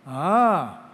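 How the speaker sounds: ordinary delivery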